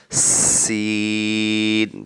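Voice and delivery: سین slowly with a long sharp hiss; whistled seen. Shown as a demonstration. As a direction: stretch the س into a whistle. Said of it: "تیز آواز سے پڑھا جائے گا".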